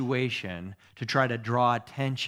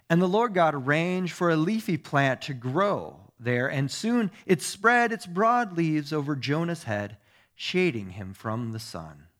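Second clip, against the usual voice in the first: emphatic, raised storytelling voice easing off; scripture reading; 3 semitones higher, 4 dB louder than usual. Acted. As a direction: read the Bible story out to a congregation. Reads scripture aloud.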